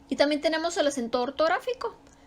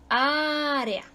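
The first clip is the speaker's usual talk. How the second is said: "área" with a long, raised first Á; stress on Á; 3 semitones higher than usual. Shown as a demonstration. Teacher giving examples of written accent, stress on Á. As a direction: hold the opening Á of "área". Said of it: "ahí cae la sílaba tónica".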